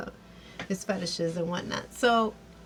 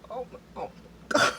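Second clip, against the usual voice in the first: awed gasp; 3 semitones higher, much faster than usual. Retold or imitated. Girls react to the actor.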